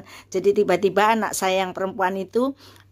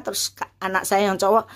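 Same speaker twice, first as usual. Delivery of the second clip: ordinary talk, nothing marked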